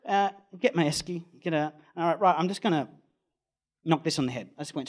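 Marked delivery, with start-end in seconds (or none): none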